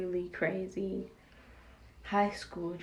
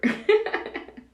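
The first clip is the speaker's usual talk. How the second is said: high laugh; 13 semitones higher, 7 dB louder than usual. Real, not acted. Laughs at her own story.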